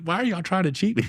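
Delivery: high-pitched mock yell, no real volume; retold yell. Competitor complaining to everybody about being cheated, retold high-pitched yell. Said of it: "I'm yelling at everybody".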